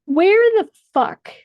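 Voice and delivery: high, loud and slow, outraged; furious shout. Real, not acted. Woman scolding Representative Schiff in an outraged shout. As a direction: yell it in disbelief.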